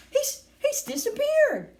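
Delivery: high, loud, stretched yell; high horrified shriek. A narrator's reading. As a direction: cry out in stammering panic. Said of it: "yelled Missus Gloop".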